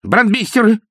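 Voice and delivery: взволнованный возглас, выше и громче; вскрикнуть в радостном волнении; взволнованно. A narrator's reading. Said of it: "заволновался вдруг Виктор Михайлович". (заволновавшись, громко)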